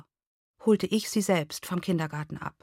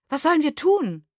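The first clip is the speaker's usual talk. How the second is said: anxious plea; 8 semitones higher, 4 dB louder than usual. A narrator's reading. Anxious mother pleading.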